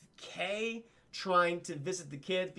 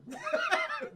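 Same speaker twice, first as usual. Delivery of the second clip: mock laugh. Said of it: "Michael's like".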